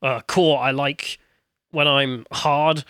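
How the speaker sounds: low fake man voice; forcing a deep voice. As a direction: drop into a hammy deep voice. Mocking phone deep voice.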